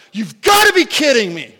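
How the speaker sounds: loud, high exasperated outburst; like slamming the desk in disbelief; retold outburst. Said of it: "I'm out loud going".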